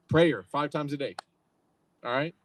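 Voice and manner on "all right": normal talking voice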